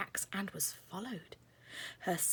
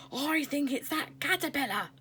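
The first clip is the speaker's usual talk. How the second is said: shouting; 8 semitones higher, 4 dB louder than usual. Acted. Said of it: "someone shouted".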